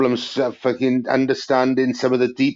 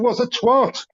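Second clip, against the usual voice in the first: mock baffled question; 9 semitones higher, slower than usual. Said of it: "I said".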